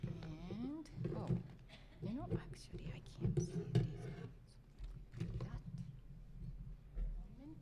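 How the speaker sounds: whispered aside; whispering. Real, not acted. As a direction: murmur under her breath. Aside while fiddling with slides.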